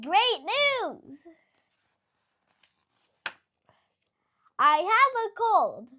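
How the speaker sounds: high, loud, delighted shouting; overjoyed. Acted. Gerald cheers to Piggy about his cold, high and loud. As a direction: cheer the good news. Gerald thrilled to be merely sick.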